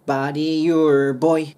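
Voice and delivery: put-on "Body your boy" with "your" squeezed together; slow model of "Body your boy", words joined; linked pronunciation. Shown as a demonstration. Teacher showing learners where to link, slow and joined. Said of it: "もうここくっつけちゃって早く読んで".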